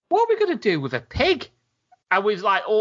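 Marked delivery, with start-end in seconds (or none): 0.1-1.5 incredulous Max. Retold or imitated. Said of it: "it's sort of like".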